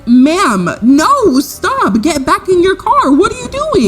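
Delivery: high, panicked pleading, climbing in pitch; alarmed dispatcher. Retold or imitated. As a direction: imitate a frantic dispatcher begging her to stop. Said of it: "and she's like".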